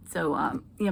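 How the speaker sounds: normal talking voice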